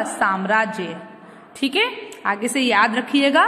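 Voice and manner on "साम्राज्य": "साम्राज्य" opening on a long "saam"; correct pronunciation. Shown as a demonstration. Teacher answering what it is, correctly pronounced. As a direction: stretch the first a of "साम्राज्य". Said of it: "शुद्ध शब्द क्या हुआ?".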